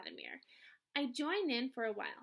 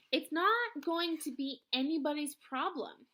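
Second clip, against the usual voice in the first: louder, slow insistence; insistent; 4 semitones higher, 6 dB louder than usual. A narrator's reading. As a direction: lay it down firmly. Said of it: "Mister Vargas insists".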